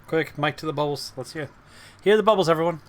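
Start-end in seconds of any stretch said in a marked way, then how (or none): none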